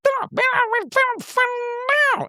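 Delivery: very high squeaky gibberish babble; little stone creature. Acted. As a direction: chatter like a tiny protesting critter. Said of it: "he's gonna be like".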